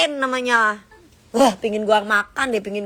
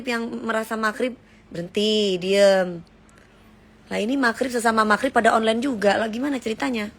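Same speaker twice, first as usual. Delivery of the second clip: ordinary delivery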